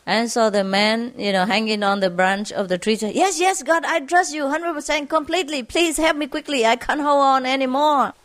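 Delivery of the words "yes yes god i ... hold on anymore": high, hurried begging; desperate pleading. Retold or imitated. Man on the branch begging God, desperate pleading. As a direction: plead urgently in a raised pitch, acted.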